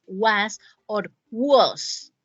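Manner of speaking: very slow, "was" said twice, first with a British open o, then with an American a; teacher's slow demo of both "was" pronunciations; two ways of saying was. Shown as a demonstration. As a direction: spell out the two "was" sounds slowly for the class. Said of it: "pronunciándole tal cual está".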